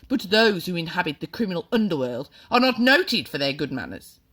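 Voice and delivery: mock his manners before the school; sneering headmistress. A narrator's reading. Trunchbull mocking the boy.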